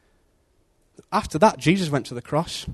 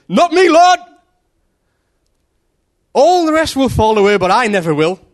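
high, loud proud protest; proud boast; 9 semitones higher, 9 dB louder than usual. Acted. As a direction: declare it with hammed-up bravado, much higher and louder. Proud Peter's boast to Jesus.

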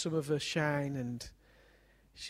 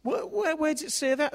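much higher, loud, incredulous challenge; indignant squeak; 12 semitones higher, 9 dB louder than usual. Retold or imitated. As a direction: squeal the question like an outraged teen. Daughter challenges father.

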